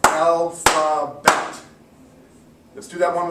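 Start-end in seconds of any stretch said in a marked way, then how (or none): 0.0-1.6 "Alphabet" very slow and loud, "Al-pha-bet"; slow syllable split. Shown as a demonstration. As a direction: clap each beat of "Al… pha… bet". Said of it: "I want everybody to clap".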